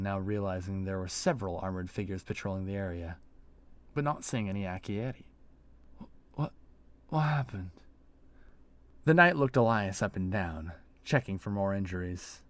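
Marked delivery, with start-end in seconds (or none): 6.0-7.7 stammered, dazed question; stammering. Acted. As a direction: stumble over it, dazed.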